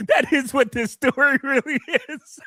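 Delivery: laughing. Real, not acted. Said while laughing.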